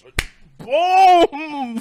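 high, loud impact shout; a loud, high punch noise; mimicked boom. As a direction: belt out the slap sound.

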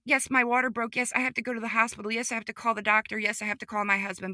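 rapid-fire list; fast, insistent run of concessions; retold impatience. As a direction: rush through it to get to the point. Brushing off practical matters to mom.

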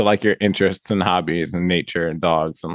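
usual speaking voice

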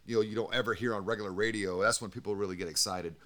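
normal talking voice

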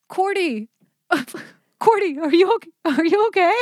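high, loud mock concern; worried sister calling. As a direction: call out to her sister, fake-worried. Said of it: "she's like".

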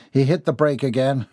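usual speaking voice

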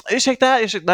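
imitated pushy approach. Young fundraiser girl accosting a passer-by, imitated pushy approach. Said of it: "de är väldigt aggressiva i sin, liksom, approach".

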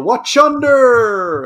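long drawn-out high shout; warning yell. Acted. Seasick ship passenger warning those below, yelled. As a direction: yell it like a warning over the rail. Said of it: "you would yell".

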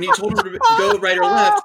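right or left, shot up high and exasperated; mock-frustrated high-pitched command; exasperated high repeat.

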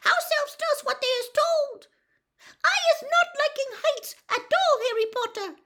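very high, loud insistent squeak; Winky, firm. A narrator's reading. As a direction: insist as a dutiful little house elf. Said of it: "said Winky firmly".

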